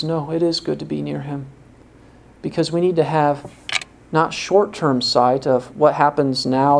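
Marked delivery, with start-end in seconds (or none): 0.0-1.5 drops soft and sure on "good to be near Him"; hushed certainty that "it is good to be near Him"; quiet firm correction. Real, not acted. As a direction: settle "it is good" low and quiet. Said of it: "But God's Word says".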